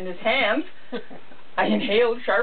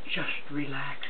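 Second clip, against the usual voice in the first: soft, low and soothing; calm him down, close to the ear; low reassuring voice; 5 semitones lower, 6 dB quieter than usual. Acted. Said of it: "spoke in a low, reassuring voice".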